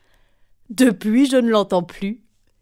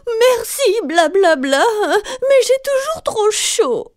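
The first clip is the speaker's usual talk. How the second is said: very high squeaky complaint; whining Galipette; 9 semitones higher, 4 dB louder than usual. A narrator's reading. Galipette thanks the mouth but still whines, very high.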